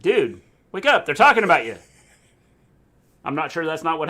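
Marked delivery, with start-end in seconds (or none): 0.0-1.7 loud, higher call to rouse someone; mock wake-up call. Commentators rousing a dozing wrestler on camera, mock wake-up call. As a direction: call out urgently, playing it for laughs. Imagined call to a sleeping spectator.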